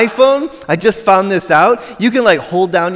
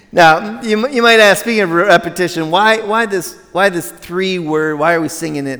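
ordinary delivery

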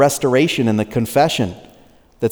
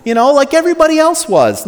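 much higher, sheepish hammed-up excuse; mock excuse; 12 semitones higher than usual. Preacher mocking Israelites excusing idol worship, much higher and hammy. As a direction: play a shrugging sinner making excuses. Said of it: "They don't say".

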